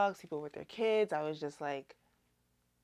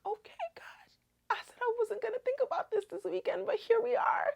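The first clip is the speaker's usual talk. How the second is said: very high, thin squeaky voice; strained high whimper of giving in; overwhelmed surrender; faster than usual. Retold or imitated. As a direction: squeak it out half-whispered, as if near tears. Retells answering God, voice goes high.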